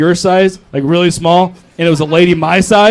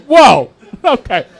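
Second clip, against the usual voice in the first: mock shocked whoa; 6 semitones higher, slower than usual. Audience hearing wife was bought, mock shock. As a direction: recoil in mock offence.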